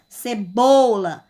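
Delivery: Cebola slowly, with a drawn-out BO; stress on BO. Shown as a demonstration. Teacher letting pupils hear the stronger sound, BO stressed in Cebola. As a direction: lean hard on BO in Cebola. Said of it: "Vocês ouvem mais o som dela".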